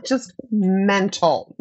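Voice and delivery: laughing slowly through it; amused, drawn-out repeat. Real, not acted.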